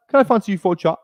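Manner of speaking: badly faked British pick-up line; put-on UK accent. Streamer playing a Love Islander, UK accent. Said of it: "my U K accent".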